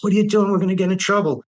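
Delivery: panicky, afraid of getting caught; nervous roommate. Retold or imitated.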